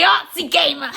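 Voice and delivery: giggling through the nickname; laughing. Real, not acted. Vlogger laughing while reading a fan's note. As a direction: laugh it out, tickled by the name.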